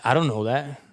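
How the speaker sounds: ordinary talk, nothing marked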